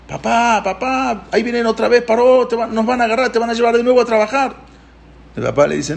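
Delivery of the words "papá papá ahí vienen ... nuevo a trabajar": high, urgent child's wail, acted out; mock crying children. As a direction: imitate frightened kids calling for dad. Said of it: "empezaron a llorar, los niños".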